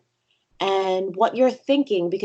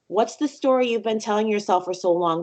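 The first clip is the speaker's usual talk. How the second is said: ordinary talk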